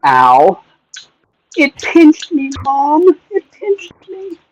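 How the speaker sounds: ordinary talk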